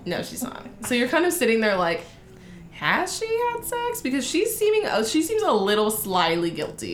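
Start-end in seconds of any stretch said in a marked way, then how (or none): 2.7-4.0 mock suspicious question. Voicing viewer's suspicion.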